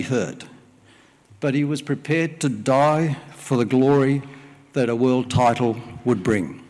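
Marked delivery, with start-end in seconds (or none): none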